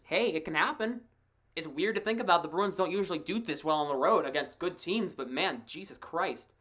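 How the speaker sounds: normal talking voice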